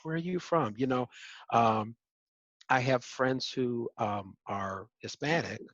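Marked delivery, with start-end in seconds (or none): 0.0-0.7 curious strangers. Retold or imitated. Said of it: "Then it's like".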